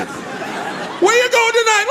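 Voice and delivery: very high, drawn-out mock shout; slow cousin voice. Cousin line repeated, slower.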